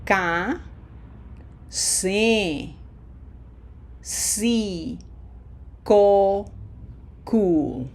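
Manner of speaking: "Ka, se, si, ko, ku" one at a time with pauses, pure vowels; Spanish pronunciation demo. Shown as a demonstration. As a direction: model "Ka, se, si, ko, ku" slowly for learners to repeat. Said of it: "Okay, let's try it".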